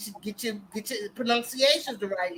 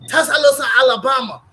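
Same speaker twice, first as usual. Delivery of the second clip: a mock shout of "Tuscaloosa, Alabama", louder; retold loud answer; 9 dB louder than usual. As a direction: call out the place name loudly, imitating the waiter. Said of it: "He said".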